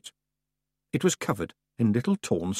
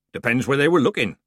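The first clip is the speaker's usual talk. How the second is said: cheerfully; 4 semitones higher, 6 dB louder than usual. A narrator's reading. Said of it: "the man began cheerfully".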